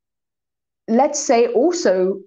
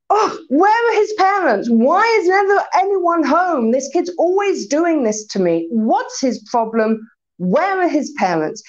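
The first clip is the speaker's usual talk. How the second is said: higher, exasperated mock outrage; indignant grumbling; 7 semitones higher than usual. Driver nearly hits a kid, indignant higher grumbling. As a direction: huff about the careless parents. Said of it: "his internal dissatisfaction".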